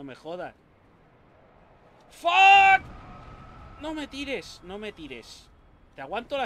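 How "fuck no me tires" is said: yelled curse at full blast; loud shout. Real, not acted. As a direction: scream it in frustration. Gamer's death in game.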